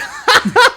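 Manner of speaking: high, loud burst of laughter at the ghost; mocking laugh. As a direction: laugh right in its face.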